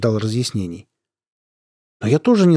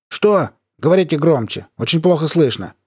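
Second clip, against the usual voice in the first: raised, higher voice over a bad line; to someone hard of hearing; 5 semitones higher than usual. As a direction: call out as if the line is breaking up. Fakes bad line, shouting.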